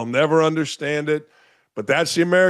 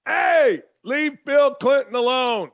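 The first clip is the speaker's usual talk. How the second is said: much higher, slow, shouted mock protest; mock outraged liberal; 9 semitones higher, slower than usual. Mocking the left defending Bill Clinton, high slow mock shout. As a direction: shriek it like a whiny defender.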